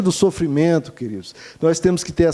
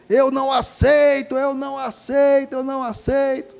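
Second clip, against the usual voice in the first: retold shouting; 7 semitones higher than usual. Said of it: "gritando bem alto mesmo".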